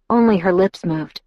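ordinary delivery, nothing marked

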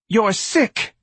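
very high, loud accusation; wife's shout. A narrator's reading. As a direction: shout it at the husband. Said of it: "Missus Levy shouted at her husband".

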